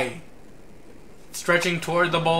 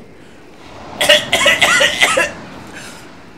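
falsetto cough; 6 dB louder than usual. Acted. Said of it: "It's not easy to do a girl voice for me".

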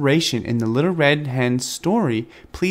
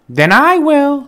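much higher and louder; a stubborn little hen; determined hen; 13 semitones higher, 8 dB louder, slower than usual. A narrator's reading. The hen taking on the work herself, high and firm. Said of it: "said the little red hen".

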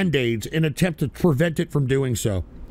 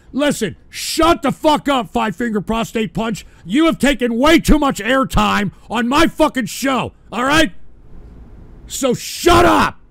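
loud, furious rant at a troll; angry yelling; 4 semitones higher, 4 dB louder than usual. Real, not acted. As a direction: yell him off your show.

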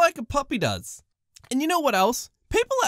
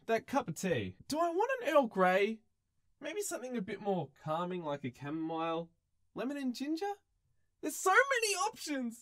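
much softer, musing to himself; trailing off; 10 dB quieter than usual. Real, not acted. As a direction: drift away mumbling about tea. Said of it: "muttering off to make tea".

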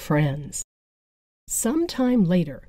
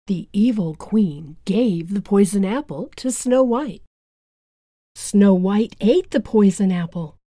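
ordinary delivery

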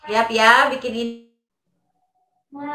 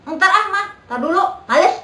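imitating a child whining higher and louder at mom; whiny child; 8 semitones higher, 7 dB louder than usual. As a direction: play the lazy kid putting mom off. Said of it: "kita bilang". (menirukan anak merengek malas ke mama)

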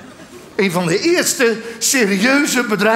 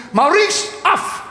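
put-on child calling; 8 semitones higher, much slower than usual. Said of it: "een kinderstem die roept".

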